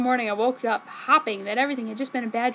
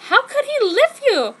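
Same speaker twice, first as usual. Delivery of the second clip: indignant exclaim; 12 semitones higher, 7 dB louder than usual. Acted. Friend exclaims sympathy.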